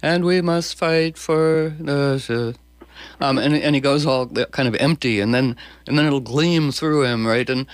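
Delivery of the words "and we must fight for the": slow, higher imitation of Trump trailing off; Trump impression. Guest mimics Trump going empty at the end of a sentence. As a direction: drag it out like Trump running on empty. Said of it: "like when he goes down at the end".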